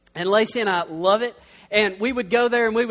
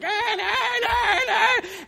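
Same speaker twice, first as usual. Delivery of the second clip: exasperated parent; 11 semitones higher, much slower than usual. Retold or imitated. Kids clawing at parents.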